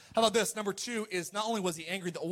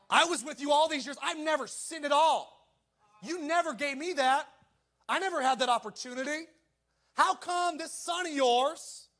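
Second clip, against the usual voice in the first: put-on higher, whiny older brother; resentful older brother; 7 semitones higher than usual. Older son to his father, a higher resentful whine. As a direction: complain like the slighted son. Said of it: "This is what his attitude was".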